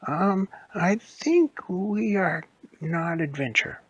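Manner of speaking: high, slow hesitant voice; nervous animatronic confessing; timid Chica. Acted. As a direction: say it meekly and slowly. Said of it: "Chica said".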